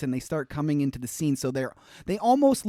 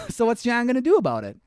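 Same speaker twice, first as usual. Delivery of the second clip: normal talking voice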